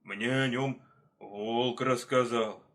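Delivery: slow, lumbering bear voice; heavy, drawn-out delivery. Acted. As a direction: answer ponderously, drawing words out. Bear answering the leopard.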